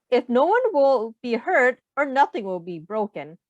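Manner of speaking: ordinary delivery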